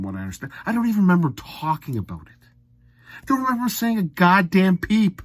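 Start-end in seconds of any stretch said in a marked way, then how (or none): none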